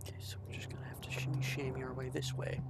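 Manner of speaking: hushed sneaking whisper. Real, not acted. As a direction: whisper so it won't hear. Sneaking past a monster.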